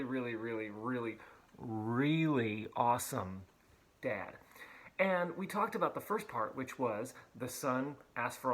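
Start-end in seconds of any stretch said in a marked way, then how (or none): 0.0-4.3 each really stretched, slow, building to awesome; slow, emphatic really really awesome dad; drawn-out emphasis. Shown as a demonstration. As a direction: savor every really, slowly. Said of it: "I said it should be called".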